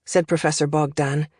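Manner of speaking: plain reading voice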